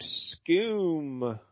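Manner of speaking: drawn-out, softened "scoom"; spoken sound effect. Acted. Host voices the comic's panel noise, spoken sound effect. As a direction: stretch the vowel like a falling whoosh. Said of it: "we get the sound effect".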